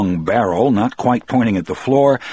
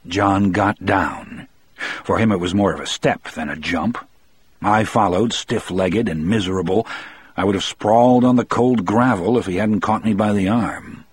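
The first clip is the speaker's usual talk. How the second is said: ordinary delivery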